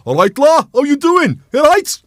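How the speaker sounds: loud, high-pitched Scouse-style greeting; mock Scouse-Wrexham accent. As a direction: shout a hammed-up Scouse hello.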